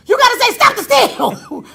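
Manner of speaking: loud, high, slow hype-up; hammed-up excited coach pushing the slogan; over-the-top coaching. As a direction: retell it as a high, loud, overexcited demand. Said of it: "just put an emphasis on it".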